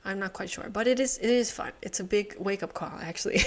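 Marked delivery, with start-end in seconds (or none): none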